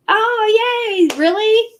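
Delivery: light up at happy news; thrilled surprise; delighted squeal. Real, not acted. Reacting to chat birthday.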